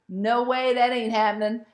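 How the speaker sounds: firm, loud no-way; emphatic refusal. Retold or imitated. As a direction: dig in and refuse, loudly. Retelling refusal to the book.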